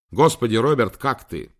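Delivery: alarmed exclamation. A narrator's reading. Editor writing to a friend after shocking news, alarmed. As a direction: read it like a worried message in capitals. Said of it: "писал все слова заглавными буквами и ставил столько восклицательных".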